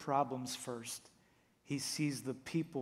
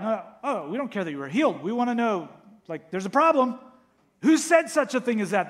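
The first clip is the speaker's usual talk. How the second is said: mock indignant demand; 8 semitones higher, 13 dB louder, faster than usual. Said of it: "they're like".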